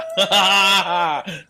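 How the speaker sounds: breaking into a loud laugh. Real, not acted. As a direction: crack up out of character. Villain laughs after line. (หัวเราะหลุดบท)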